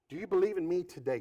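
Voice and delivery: husband pushing the question to his wife. Retold or imitated. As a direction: press the question quickly. Same question, faster.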